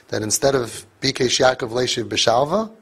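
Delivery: usual speaking voice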